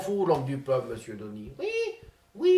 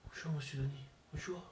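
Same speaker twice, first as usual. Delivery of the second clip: Zinedine Zidane impression; 2 semitones lower, 7 dB quieter than usual. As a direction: mumble like a shy, sulky footballer. Comedian impersonates Zinedine Zidane.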